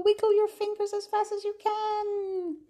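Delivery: high sing-song instruction; high and excited. Shown as a demonstration. Librarian urging babies to wiggle fingers, high excited voice. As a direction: stay up high and quick.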